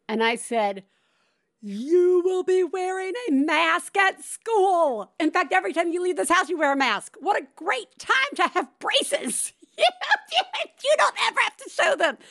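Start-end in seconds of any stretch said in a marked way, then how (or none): 1.6-9.5 higher, louder, selling the good news; beaming mom revealing the upside of masks; excited pitch. Retold or imitated. 9.8-10.8 high delighted laugh. Real, not acted. Laughing at own genius. 10.8-12.2 rushed and laughing through it; fast, high, giggling delivery. Retold or imitated. Mom finishing pitch laughing.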